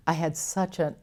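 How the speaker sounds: ordinary delivery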